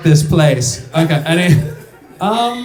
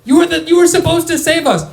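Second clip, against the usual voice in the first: high-pitched yell of outrage; retold heckle, high and strained; heckling shout; 13 semitones higher than usual. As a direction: shout it like an aggrieved fan at the court. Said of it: "and I would shout".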